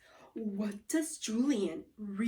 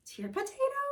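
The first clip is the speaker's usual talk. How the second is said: puzzled; 13 semitones higher, 3 dB quieter than usual. Acted. Kid puzzled by odd word.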